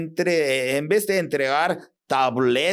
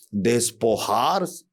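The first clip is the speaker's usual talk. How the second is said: usual speaking voice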